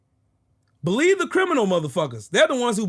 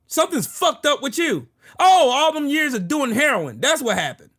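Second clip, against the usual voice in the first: ordinary talk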